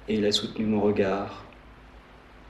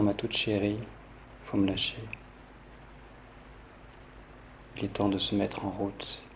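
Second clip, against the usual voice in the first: tender farewell; 10 dB quieter than usual. A narrator's reading. Dying speaker asks a beloved to let go, tender and hushed. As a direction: read it softly, as an intimate last goodbye.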